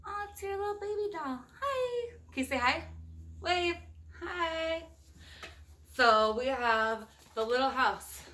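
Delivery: ordinary delivery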